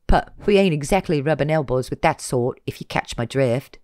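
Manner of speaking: put-on casual cocky street kid; drawled with slangy swagger; street teen voice.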